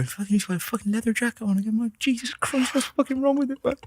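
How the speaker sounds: high, soft, fast mock grumbling; whisper-griping as a gossiping relative; mocking mourners' grumble. At the funeral, mimicking relatives' grumbles, high and hushed. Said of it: "you could hear everyone go".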